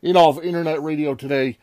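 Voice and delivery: booming ring announcer intro; grand entrance announcement. Acted. Wrestling-style podcast intro.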